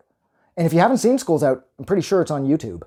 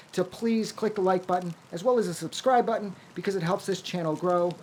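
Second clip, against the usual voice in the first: softer, higher sign-off read to viewers; quiet closing thanks to viewers; soft outro; 4 semitones higher, 7 dB quieter than usual. Acted. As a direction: thank them gently, winding down.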